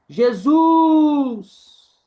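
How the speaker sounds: loud, high drawn-out call; calling far away. Shown as a demonstration. Teacher demonstrating stressed syllable by shouting to someone far away. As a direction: stretch the last syllable as if hailing someone distant. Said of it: "como você estivesse gritando para alguém bem longe".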